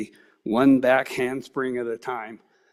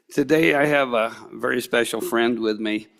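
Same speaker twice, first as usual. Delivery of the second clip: ordinary talk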